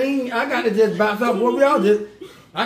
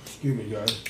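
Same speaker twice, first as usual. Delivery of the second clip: much lower, much softer dainty apology; prim excuse me; 12 semitones lower, 10 dB quieter than usual. Retold or imitated. To viewers after a burp, low and hushed.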